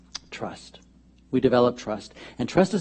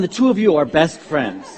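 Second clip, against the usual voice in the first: usual speaking voice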